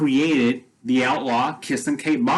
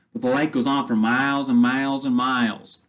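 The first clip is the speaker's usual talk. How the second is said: usual speaking voice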